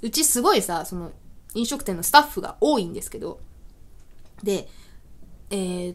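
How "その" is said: ordinary talk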